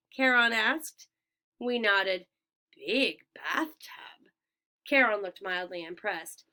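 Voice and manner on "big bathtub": slow, breathy deadpan; dry whisper. A narrator's reading. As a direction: murmur it with dry doubt. Charon dry skeptical remark.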